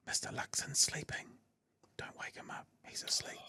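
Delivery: put-on hushed whisper, don't wake the baby; whispering over a crib. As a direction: whisper so the sleeper stays asleep. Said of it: "and shh".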